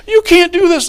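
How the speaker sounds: very high, loud acted protest; hammed-up marital shouting; outraged yell. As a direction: play a wife shrieking in a quarrel. Wife in acted quarrel.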